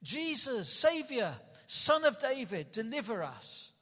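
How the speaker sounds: loud, high crowd acclamation, easing at the end; retold crowd shouting. Preacher voicing hundreds hailing their Savior, shouted out. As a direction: imitate a crowd calling out for deliverance. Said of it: "And they were shouting".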